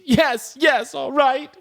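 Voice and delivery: high-pitched mock surrender; exasperated giving in. Lucy giving in to the man, mock exasperated. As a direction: imitate a badgered kid relenting. Retold girl giving in to bullying.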